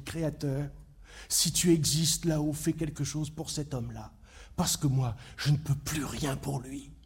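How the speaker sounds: low, soft prayer to the Creator; soft, helpless appeal to heaven; hushed plea. Acted. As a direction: plead quietly, nearly given up. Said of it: "a regardé le ciel et a dit".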